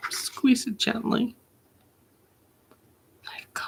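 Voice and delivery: fading to a soft, fragile hush; hushed grief. A narrator's reading. Poet at a loved one's hospital bedside, hushed grief.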